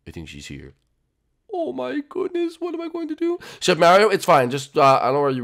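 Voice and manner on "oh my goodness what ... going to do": high, soft, fretful falsetto; small panicked wail. Acted. Chef as his date arrives, soft high panic.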